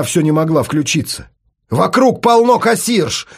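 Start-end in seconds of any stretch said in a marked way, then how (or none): 1.7-3.3 higher, slow shout; slow, high yell to spur her on. Acted. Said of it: "кричал ей Артём". (крик, высоко и медленно)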